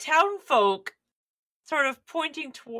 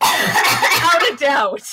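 drawn out, slow and louder; slow emphatic; 6 semitones higher, 8 dB louder, much slower than usual. A narrator's reading. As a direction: lean on every word.

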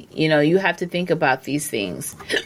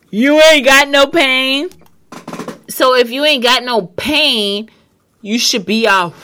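emphatic loud preaching; 7 semitones higher, 6 dB louder than usual. Real, not acted.

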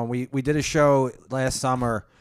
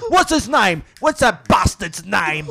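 raised, impatient put-on voice; mock snapping; 4 semitones higher, 5 dB louder, slower than usual. Headliner snapping at comics for a forgotten name, mock irate demand. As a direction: imitate an irritable man demanding an answer. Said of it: "He's just snapping at us".

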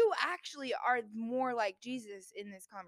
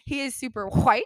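normal talking voice

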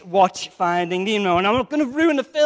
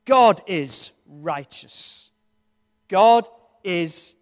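slow; 5 semitones lower, much slower than usual. Acted. Preacher weighing a key word.

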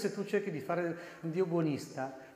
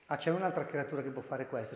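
usual speaking voice

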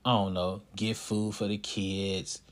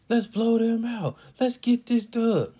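high, loud, slow mocking chant; mock the fans begging for a blowout; imitating yelling fans; 13 semitones higher, 4 dB louder than usual. Fans in the stands demanding a blowout, loud mock yell. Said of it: "I got the fans just up there yelling".

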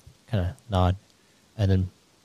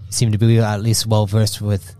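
normal talking voice

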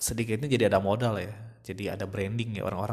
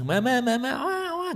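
put-on high-pitched baby babbling into a wail; imitated baby babble; 14 semitones higher than usual. Speaker mimics a baby trying to tell parents, wailing babble. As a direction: mimic a baby's wordless cry. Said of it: "Dengan bahasa yang".

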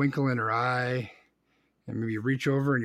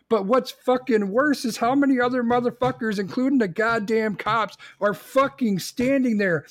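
higher, louder outraged ranting; furious rant; 7 semitones higher, 3 dB louder than usual. Real, not acted. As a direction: let the rage boil over at the bystanders. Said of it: "'cause I'm really pissed at this shit".

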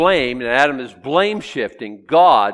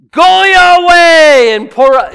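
far higher, much louder and slow, Go your way roared; raging God; 17 dB louder, slower than usual. Retold or imitated. God sending the angels in a roaring rage. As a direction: bellow the command in rage. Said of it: "God's red face with rage".

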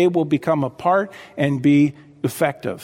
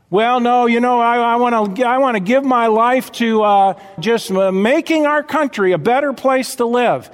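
mock objector; 6 semitones higher than usual. Pastor voicing a complacent churchgoer, mildly mocking. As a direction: lightly play the worldly believer.

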